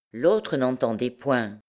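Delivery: ordinary delivery, nothing marked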